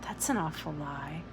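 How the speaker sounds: soft, low hushed retort; girl's quiet whispered jab. A narrator's reading. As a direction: hiss it back quietly. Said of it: "She whispered back".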